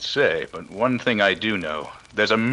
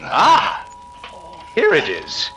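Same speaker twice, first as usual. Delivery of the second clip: triumphant discovery; 4 semitones higher, slower than usual. Acted. Finds the hidden loudspeaker.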